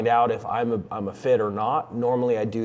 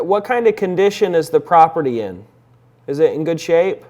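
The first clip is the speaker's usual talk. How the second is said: raised, higher phone voice; loud phone voice; 6 semitones higher, 10 dB louder than usual. Real, not acted. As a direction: project up for a weak line.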